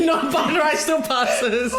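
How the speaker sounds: normal talking voice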